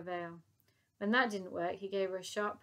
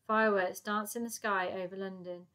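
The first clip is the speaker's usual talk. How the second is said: plain reading voice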